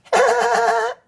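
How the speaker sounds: put-on high giggling little boy laugh; child laugh. Boy licked by a dog, high giggle. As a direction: giggle as the dog licks you. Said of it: "Caillou laughed".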